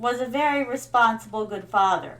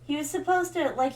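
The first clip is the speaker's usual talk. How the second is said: ordinary talk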